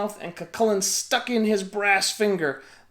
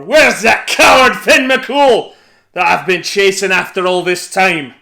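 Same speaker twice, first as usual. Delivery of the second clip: booming giant voice, much louder; giant's bellow; 14 dB louder than usual. A narrator's reading. Giant demanding Finn at the door, booming. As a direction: shout it like an angry giant hunting. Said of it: "bellowed the giant at the door".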